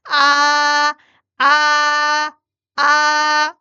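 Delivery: loud high flat 아 held steady; level first tone. Shown as a demonstration. Teacher drilling first tone again, flat high 아. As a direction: keep 아 dead level and high. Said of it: "일 성, 평평하게".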